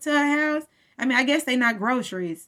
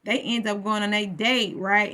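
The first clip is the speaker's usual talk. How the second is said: ordinary delivery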